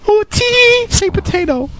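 high squealing imitation of coworkers; teasing coworkers. Coworkers begging to hear potato, high mocking imitation. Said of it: "all the girls I work with are always like".

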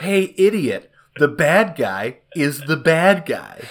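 loud, high, slow spelling-it-out; hammed-up yell, every word hammered slowly; mock exasperated shout. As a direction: shout it as if to an idiot. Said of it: "shout at it and be like".

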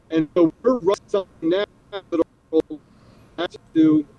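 audio cutting out; frozen video call. Real, not acted. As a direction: signal dropping, words lost. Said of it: "Looks like I froze".

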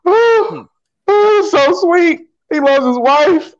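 very high, drawn-out weepy gush; mock sobbing. Co-host overcome by the husband's love message, mock sobbing. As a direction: wail it slowly as if overcome by sweetness. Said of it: "I'm tearing up".

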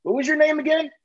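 mock the cashier who forgot; clueless cashier. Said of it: "she turns around and she goes".

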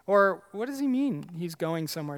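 ordinary talk, nothing marked

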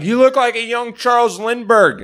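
high mock shout; retold yell. Punk heckler yelling at the comic on stage, mock furious shout. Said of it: "He yelled at me".

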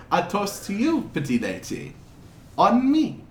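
put-on lilting, vaguely European accent, pitch swooping up; wandering foreign accent. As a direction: play a courtly stranger with a muddled European accent. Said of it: "his accent keeps jumping around a lot".

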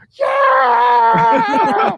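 very high, loud laughing yell; mock ecstatic scream. Friend cheering the fighter, high laughing scream. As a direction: shriek like a fan losing it. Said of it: "she's going crazy".

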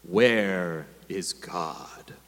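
stern rabbi repeating. A narrator's reading. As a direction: lean in and drag it out, deep and heavy. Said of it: "The rabbi repeated the question".

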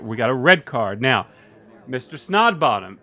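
normal talking voice

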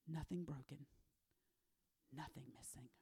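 breathy hushed whisper; nothing missing, whispered. Real, not acted. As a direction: whisper it like a secret. Preacher drops to a hush.

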